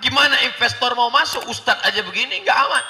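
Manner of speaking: fast, high, loud fretting about danger; alarmed gossip. Retold or imitated. People fretting aloud that Jakarta is unsafe, high alarmed voice. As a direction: mimic worried people crying it's unsafe. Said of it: "orang menyangka".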